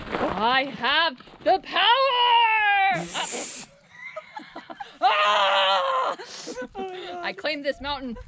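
triumphant high shout from a summit; mock-authoritative. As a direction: bellow it like a conquering hero. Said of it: "you're all authoritative".

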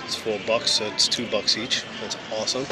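ordinary delivery

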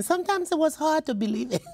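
high, quick and laughing; half-laughing. Real, not acted. Author to interviewer about wartime faith, rising into laughter. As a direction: toss it off with a rueful laugh.

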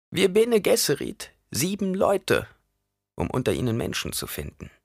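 high-pitched crone voice; old woman explaining, pitched well up; instructing old woman. A narrator's reading. Reverend mother explains her order's purpose, high voice.

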